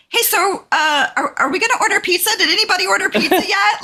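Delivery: high, awkward change of subject; change the subject too brightly. Retold or imitated. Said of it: "she's like".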